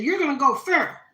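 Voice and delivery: a proud Southern grandma urging her grandbaby on; loud, high-pitched grandma blessing; grandma's voice. Retold or imitated. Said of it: "No, she said".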